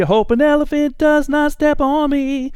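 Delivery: very high squeaky cartoon voice. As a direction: chirp it way up high. Silly squeaky mic check.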